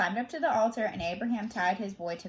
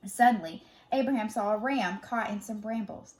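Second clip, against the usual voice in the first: ordinary delivery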